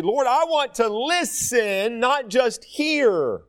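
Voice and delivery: sincere, slow prayer; earnest prayer. Acted. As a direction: lean in and plead gently. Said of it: "We gotta focus and say".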